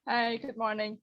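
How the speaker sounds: ordinary talk, nothing marked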